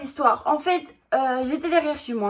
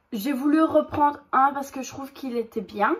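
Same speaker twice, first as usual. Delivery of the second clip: normal talking voice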